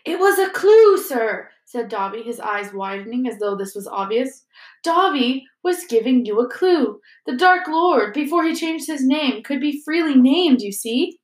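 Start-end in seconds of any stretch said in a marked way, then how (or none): 0.0-1.5 high, loud elf voice; insistent elf. A narrator's reading. Elf telling Harry what was obvious, high and insistent. 4.8-11.2 raised, eager elf voice; explaining elf. A narrator's reading. Elf explaining his clue to Harry, high and insistent.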